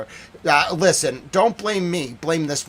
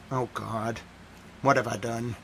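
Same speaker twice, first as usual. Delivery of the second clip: mock regret; 5 semitones lower, 8 dB quieter than usual. Regretting starting a troll war.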